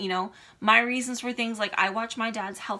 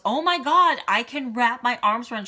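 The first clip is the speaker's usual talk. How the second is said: excited friend quote; 4 semitones higher, 7 dB louder than usual. Retold or imitated. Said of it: "she hugged me and she goes".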